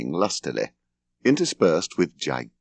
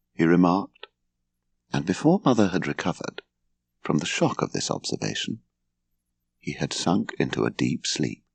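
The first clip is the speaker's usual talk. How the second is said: usual speaking voice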